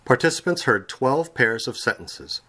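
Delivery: ordinary delivery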